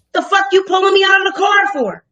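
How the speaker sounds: loud, high furious demand; angry shout. Retold or imitated. Shouting at the deputy who pulled her over, furious. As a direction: yell it in his face.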